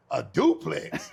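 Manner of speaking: slow, drawn-out "A duplex?"; amazed echo, slowed way down; stunned disbelief. Retold or imitated. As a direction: repeat it back in slow disbelief, half laughing. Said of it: "And I was like".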